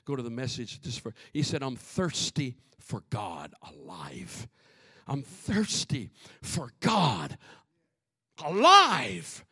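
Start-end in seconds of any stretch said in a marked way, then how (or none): 1.6-4.5 very low, slow, soft verse; hushed reading. Acted. Preacher reading the psalm's thirst for God, hushed and slow. 5.1-9.4 building declaration. Acted. Preacher repeating the psalm's thirst, slow building declaration.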